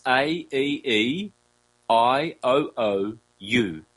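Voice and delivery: A, E, E, I, O, O, U as pure vowels, the two E's and two O's kept distinct; A, E, E, I, O, O, U spoken as clear pure vowels; seven vowels demo. Shown as a demonstration. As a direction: go through A, E, E, I, O, O, U carefully for learners. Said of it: "do this for the recording".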